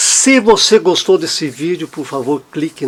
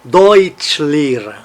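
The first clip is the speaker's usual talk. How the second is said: very slow Deutschlehrer, DEUTSCH strongest, LEH lighter; stress on Deutsch; much slower than usual. Shown as a demonstration. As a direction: draw out Deutschlehrer, leaning on Deutsch. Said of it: "a primeira fica um pouquinho mais forte". (Deutschlehrer bem devagar, Deutsch mais forte)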